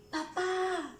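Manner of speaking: high, sustained call; child calling across the house. Shown as a demonstration. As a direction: summon a parent with a long high vowel. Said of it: "llamándolos".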